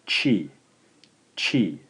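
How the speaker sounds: chi said as "chee", twice; chi with a ch sound, not t; demonstrated pronunciation. Shown as a demonstration. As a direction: model chi as a lone syllable. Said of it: "we'll pronounce this one as".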